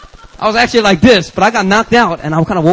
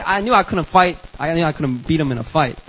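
ordinary talk, nothing marked

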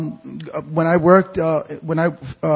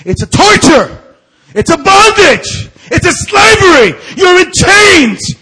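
a much higher, louder cry of torment; anguished shout; 13 semitones higher, 10 dB louder than usual. Acted. Preacher voicing the tormented mind, crying out in anguish. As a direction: shout it like someone in chains.

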